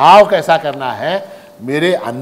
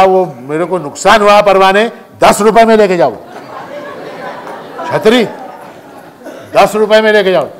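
frustrated shopkeeper; 3 semitones higher than usual. Retold or imitated. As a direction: vent the loss at the customer. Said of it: "दुकानदार इतना झल्लाया".

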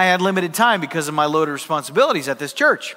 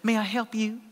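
soft, sweet Arkansas gal; lightly voiced Arkansas receptionist, sweetly; Arkansas accent; 3 semitones higher, 8 dB quieter than usual. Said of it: "sweet little gal with an Arkansas accent".

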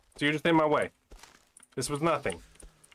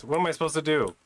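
scared horror game streamer; 4 semitones higher than usual. Real, not acted.

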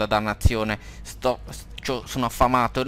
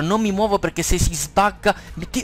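normal talking voice

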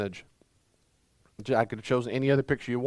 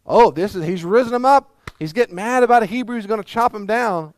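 much higher and louder, rushing on; excited build; 7 semitones higher, 7 dB louder than usual. Real, not acted. Preacher to the church on Moses, fired-up and loud. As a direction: preach it at full force.

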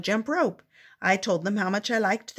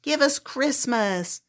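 demanding shout; 6 semitones higher, slower than usual. Acted. Trolls demanding Christmas, in a slow mock shout. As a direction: insist on getting Christmas. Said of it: "they shouted".

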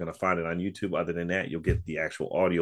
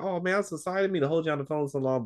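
put-on apologetic old man; 10 semitones higher, faster than usual. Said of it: "He's like".

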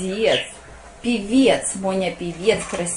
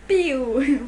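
laser gun pew; 4 semitones higher than usual. Shown as a demonstration. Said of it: "Вот так он стреляет".

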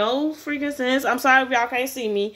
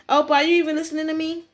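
put-on high, quick girlish complaint; higher-pitched pouty girl voice; whiny girl; 4 semitones higher, faster than usual. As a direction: pester him as an ignored admirer. Girl calls the boy ignoring her.

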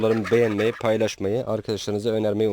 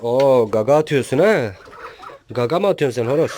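teasing shout; 3 semitones higher, 9 dB louder than usual. Real, not acted. Rooster pecks at him.

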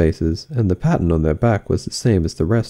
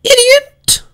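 shrieking, very high, loud insult; Queen scorning the silent Knave; furious Queen; 6 dB louder than usual. Acted. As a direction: spit the insult.

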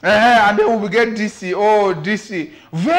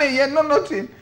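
ordinary talk, nothing marked

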